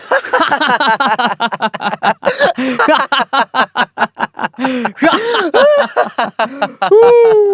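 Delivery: long, high-pitched cackling; uncontrolled laughter. Acted. Companions cannot help laughing at the actor, cackling. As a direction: laugh on and on in rapid bursts. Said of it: "इतना क्यों हंस रहे हो?".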